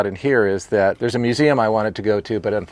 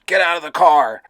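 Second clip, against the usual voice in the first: loud, high-pitched cop's yell; imitated yell; 8 semitones higher, 7 dB louder than usual. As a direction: yell it like police ordering someone out. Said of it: "yelling at the top of their lungs".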